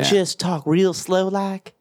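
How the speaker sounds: slow, stretched mock Southern drawl; Southern twang. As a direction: imitate a slow-talking Southerner.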